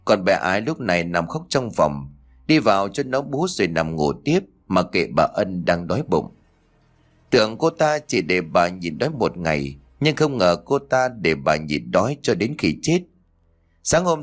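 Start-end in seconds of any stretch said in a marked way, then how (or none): none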